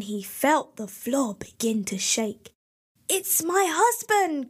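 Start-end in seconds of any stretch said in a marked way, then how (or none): 3.1-4.5 high, alarmed cry of warning; frightened cry. A narrator's reading. Wife warning Jack her husband is coming, high frightened cry. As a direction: gasp it out in sudden fright. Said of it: "cried the woman".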